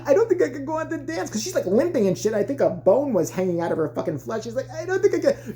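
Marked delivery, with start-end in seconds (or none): 0.1-1.3 put-on high, halting little-girl plea; lightly voiced hurt kid, pitched up; injured young dancer. Injured girl telling her coach she can't dance, high plea. 4.7-5.5 high, small mock plea; injured young dancer. Injured girl pleading with her coach, high mock plea.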